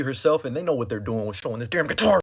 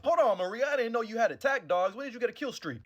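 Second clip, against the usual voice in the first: much higher, teasing voice; mock amazed; 8 semitones higher, 4 dB quieter than usual. Joking to Maria about her dogs, high mock wonder. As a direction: gush like a gamer impressed.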